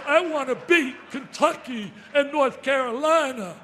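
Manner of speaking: full-throated rallying cry; fired-up shout. Retold or imitated. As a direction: yell it like a coach firing up the room. Fired-up retold pep talk.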